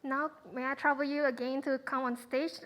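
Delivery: usual speaking voice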